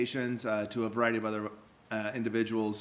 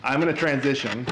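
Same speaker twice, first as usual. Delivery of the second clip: ordinary talk, nothing marked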